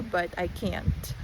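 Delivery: ordinary delivery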